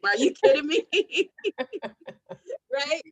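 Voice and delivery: a real laugh spilling over the question; laughing disbelief. Real, not acted. Laughs at own isolation.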